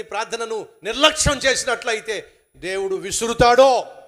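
loud, emphatic preaching; loud preaching, with the stone line said very slowly; thundering warning. Acted. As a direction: warn them God will throw a stone.

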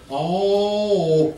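slow North Carolina folksy drawl; very slow, low small-town North Carolina voice; Andy Griffith. Acted. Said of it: "you talk like Andy Griffith".

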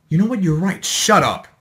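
sudden loud retort; irritated outburst; angry snap. Acted. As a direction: snap back at them, loud.

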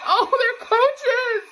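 giddy laugh at the coaches' reaction; laughing delight. Real, not acted. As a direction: burst out laughing with joy. Thrilled seeing the coaches react.

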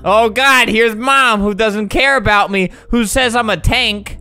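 normal talking voice

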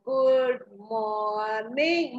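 "good morning" with the vowels stretched very slowly; sweet, put-on drawl; affected drawl. Shown as a demonstration. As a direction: drag "morning" out as long as it will go. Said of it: "Morning too is stretched even further".

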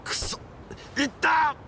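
sudden high yelp of pain; curse, then cry out at the wound; pained outburst. Acted. Wounded man cursing as the wound hurts, high pained yelp.

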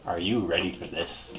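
usual speaking voice

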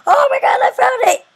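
high, loud shout of joy; excited shout. Real, not acted. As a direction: yell it out, thrilled. Finds the building at last.

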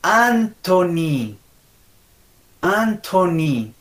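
"Anthony" very slow, syllable by syllable; drawn-out model "Anthony"; correct pronunciation. Shown as a demonstration. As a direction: stretch AAN-tho-ny, falling. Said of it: "vamos a pronunciar estos nombres".

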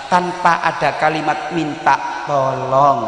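ordinary talk, nothing marked

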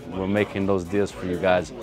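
usual speaking voice

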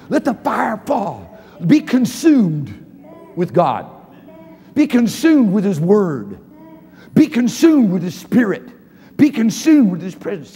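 slow, loud, drawn-out exhortation; preaching at full force. Acted. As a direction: lean into each be consumed.

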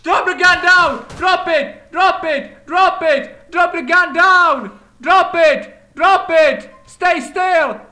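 loud, high, urgent shouted commands; yelling at gunman. Acted. Officer to an armed suspect, frantic loud yelling. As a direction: scream at him to drop the gun.

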